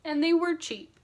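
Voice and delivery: ordinary delivery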